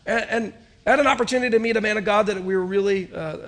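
ordinary delivery

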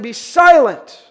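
loud, drawn-out command; stern rebuke. Acted. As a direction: ring it out slowly to silence a demon. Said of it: "Jesus rebuked him".

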